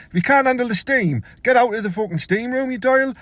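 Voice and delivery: put-on high, indignant telling-off of the old bloke; mock angry rant. Lad mocks coughing old man in steam room, high indignant rant. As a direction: scold him like he's ruined your zen.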